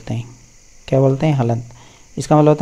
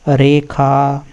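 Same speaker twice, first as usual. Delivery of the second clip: ordinary delivery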